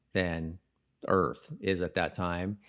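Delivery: ordinary talk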